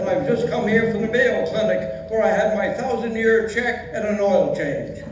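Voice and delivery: put-on old Yiddish grandpa accent; Yiddish old man voice.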